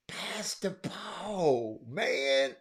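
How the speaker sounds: hushed, unvoiced, slow; not too loud. Quietly telling the boy to pass, whispered. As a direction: breathe it out softly, no holler. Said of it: "I ain't holler too loud".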